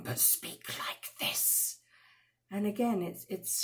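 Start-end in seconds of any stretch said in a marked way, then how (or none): 0.0-1.8 softly, slowly, with icy contempt; a hushed, menacing calm; cold quiet voice. Shown as a demonstration. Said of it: "you can not raise your voice".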